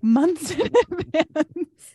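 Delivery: high, slow words breaking into laughter; laughing in disbelief. Real, not acted. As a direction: laugh through the absurdity of it. Laughing at her own story.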